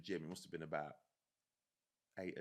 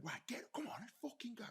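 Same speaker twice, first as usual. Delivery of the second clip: strained whispered yell, pitched high; hushed mock shout; 4 dB quieter than usual. Soldier shouting at a lad under attack, hushed mock shout. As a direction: imitate shouting orders without the volume. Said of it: "I was shouting at him".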